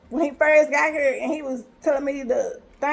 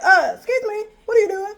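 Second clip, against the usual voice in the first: indignant challenge; 6 semitones higher, 3 dB louder than usual. Retold or imitated. Confronting the snooping man.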